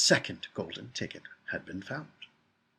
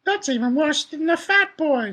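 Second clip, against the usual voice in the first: put-on very high, loud, slow old-lady voice; high-pitched grandmother, drawn out; indignant grandma; 7 dB louder than usual. A narrator's reading. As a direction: squawk it like an outraged granny. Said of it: "said Grandma Josephine".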